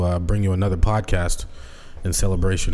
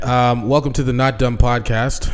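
podcast intro; 5 semitones higher, 4 dB louder than usual. Acted. Podcast host opening.

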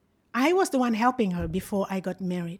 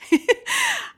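1 short laugh; 5 dB louder than usual. Real, not acted. Laughs at being called cursed.

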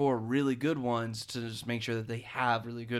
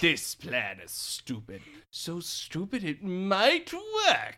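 mock-dramatic quote; quoted movie line; 4 semitones higher, slower than usual. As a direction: deliver it like a trailer line.

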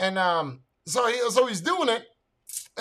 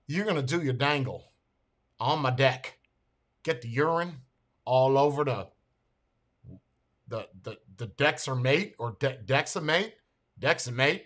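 lightly imitated Navy man, lower pitch; full-blown Navy talk; 7 semitones lower, 7 dB quieter than usual. Navy man instructs his patient, lightly imitated military talk. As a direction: put on a mild Navy-man act, spaced-out phrases. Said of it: "never heard somebody speak like this".

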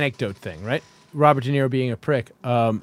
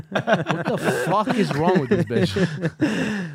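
laughing; 4 semitones higher, much slower than usual. Real, not acted. Laughing at the woman's photo.

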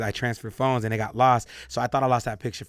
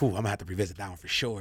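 evasive; 5 semitones lower, 7 dB quieter, faster than usual. Retold or imitated. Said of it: "I'm like".

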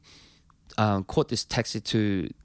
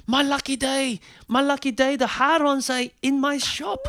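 put-on much higher, overjoyed Italian accent. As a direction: ecstatic fan in Italian accent. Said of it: "and he just".

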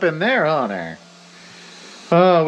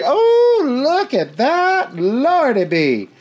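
delighted surprise; 8 semitones higher, much slower than usual. Real, not acted. Delighted exclaim.

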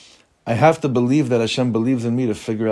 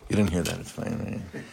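dropped to a near whisper, softer; hushed aside; 4 semitones lower, 8 dB quieter than usual. Real, not acted. Teacher slips the class a joking secret, hushed. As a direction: say it as a secret just for the room.

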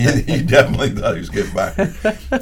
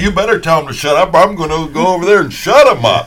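raised, loud, insistent warning; angry threat; 8 semitones higher, 8 dB louder than usual. Retold or imitated. Upset coach threatens to silence the announcer, retold angry threat. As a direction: threaten to handle it yourself.

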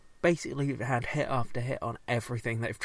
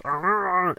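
Tusken growl; 7 semitones higher, 7 dB louder than usual. Retold or imitated. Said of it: "he's saying, "I love this".

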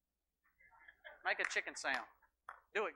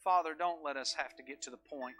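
prayer; 3 semitones lower than usual. Acted.

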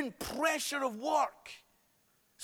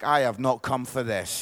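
low mock-indignant churchgoer; disapproving ladies; 12 semitones lower than usual. Preacher imitates ladies who have not come for this, low mock disapproval. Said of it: "Those ladies are like".

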